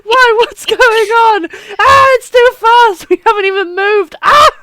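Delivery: high, loud panicked yelling; hammed-up screaming on a go-kart; mock screaming. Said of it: "he's screaming".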